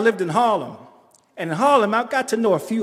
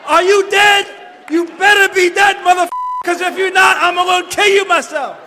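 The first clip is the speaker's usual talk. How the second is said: much higher, loud retold shouting; yelling at the corpse; 7 semitones higher, 4 dB louder than usual. Driver screaming at the body in his hearse, loud shout. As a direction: scream it at the rearview mirror like you're cursing the dead. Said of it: "I was like".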